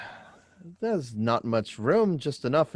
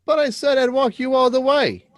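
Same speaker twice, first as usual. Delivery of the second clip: much higher and louder, protesting; loud insistence; 11 semitones higher, 7 dB louder than usual. Acted. Mike protesting to Susan, loud and high. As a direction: raise your voice, refuse to be sent off.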